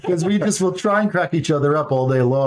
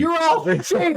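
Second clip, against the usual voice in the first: retold car-window yell; mock holler; 13 semitones higher than usual. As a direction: shout it like scolding an audience. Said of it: "I yell at audiences and call them sheep".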